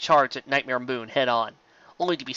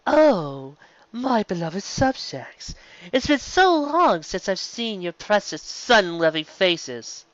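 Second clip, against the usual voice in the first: slow, higher, mocking dark queen; villain queen voice; 7 semitones higher, slower than usual. A narrator's reading. Nightmare Moon greets her subjects, slow mocking villain voice. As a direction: drip with menace and false affection. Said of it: "with an echoing tone that froze the blood".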